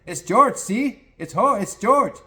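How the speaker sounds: put-on high, loud, indignant in a Central American accent; pissed jockey. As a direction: insist on the name, getting pissed. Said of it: "And the jockey goes".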